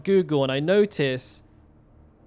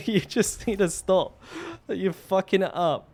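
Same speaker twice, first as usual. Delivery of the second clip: higher, strained through real laughter; laughing; 3 semitones higher than usual. Real, not acted. Repairman recalling his plea to the customer, laughing through it. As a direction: talk while cracking up.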